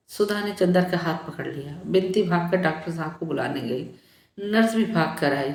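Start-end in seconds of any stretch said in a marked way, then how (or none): none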